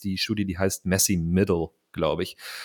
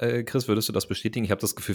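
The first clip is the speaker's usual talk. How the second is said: ordinary delivery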